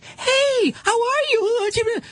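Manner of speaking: put-on squeaky high excited baby-talk, voice way up; excited greeting voice. Stranger cooing at the dog in a very high excited voice. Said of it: "they have an excited sound".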